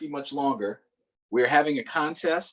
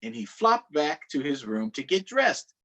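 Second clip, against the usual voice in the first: ordinary delivery, nothing marked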